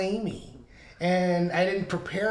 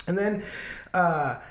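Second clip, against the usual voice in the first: ordinary talk, nothing marked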